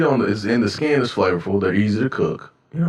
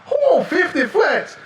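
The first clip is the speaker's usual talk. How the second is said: exasperated cook. Retold or imitated. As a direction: shout it like a fed-up line cook. Voicing the kitchen cooks.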